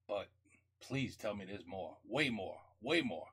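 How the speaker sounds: ordinary talk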